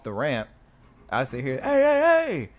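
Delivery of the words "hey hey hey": loud high shouted warning; warning yell. Retold or imitated. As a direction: shout to stop him. Yelling warning at dude.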